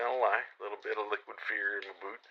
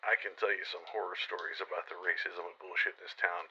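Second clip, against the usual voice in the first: normal talking voice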